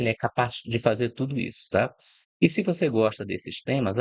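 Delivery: usual speaking voice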